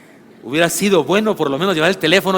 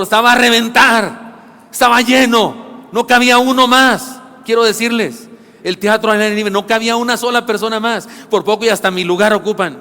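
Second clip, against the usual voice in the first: preaching at full force; 5 semitones higher, 4 dB louder than usual. Acted. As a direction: proclaim it loudly. (proclamando fuerte y lento)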